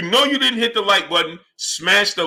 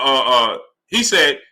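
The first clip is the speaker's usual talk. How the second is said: ordinary talk, nothing marked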